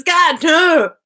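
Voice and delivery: loud, high playful plea; hammed-up begging; mock horrified protest. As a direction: cry out in pretend horror.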